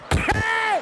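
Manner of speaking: high startled yelp at the driver; jolted, yelp it out. Retold or imitated. Sudden alarmed yelp at driver.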